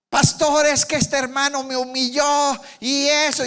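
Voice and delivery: high put-on voice whining to the pastor; complaining church member. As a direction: play the offended brother grumbling. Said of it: "Y yo le decía".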